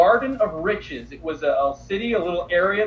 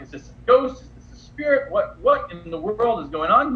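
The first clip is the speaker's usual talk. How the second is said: freaked-out disciples; 4 semitones higher, 3 dB louder than usual. Retold or imitated. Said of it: "freaks them out at first".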